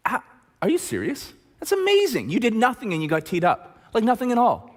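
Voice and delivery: high, sarcastic wide-eyed disbelief; mock amazement. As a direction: fake amazement to call the bluff. Coach mocks player's excuse.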